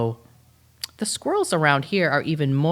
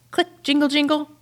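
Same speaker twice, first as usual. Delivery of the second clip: jingle; 11 semitones higher, 4 dB louder than usual. Acted. Dog hears the gate jingle.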